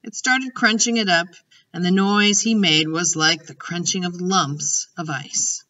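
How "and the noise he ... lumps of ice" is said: ordinary delivery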